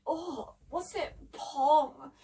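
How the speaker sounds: drawn-out grumble of disgust; slow, griping complaint; grumbling. A narrator's reading. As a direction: grumble it slowly, put off by a stink. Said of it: "grumbled Debbie".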